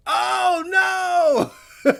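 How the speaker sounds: high panicked shout; panicking gamer. Real, not acted. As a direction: cry out in alarm. Player being killed.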